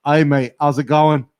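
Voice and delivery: lightly put-on Aussie greeting; casual mock Australian accent; fake Australian accent. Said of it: "like, a fake Australian accent".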